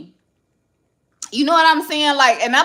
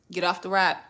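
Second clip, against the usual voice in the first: normal talking voice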